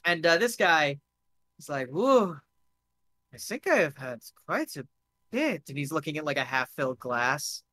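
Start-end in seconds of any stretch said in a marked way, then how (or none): 1.9-5.6 lightly played tipsy, halting accent; tipsy foreign guest. Drunk guest eyes his half-filled glass, tipsy and accented. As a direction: waver through a tipsy accented admission.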